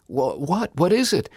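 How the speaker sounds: high, alarmed questioning; startled, just woken. Retold or imitated. Sister woken at night by her brother, startled. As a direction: sister jolted awake by a late call.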